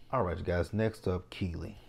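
ordinary talk

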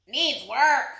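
loud, quacky duck verdict; punchy duck critic; Duck's critique. A narrator's reading. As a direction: pronounce judgement in a duck voice. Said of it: "Duck noted".

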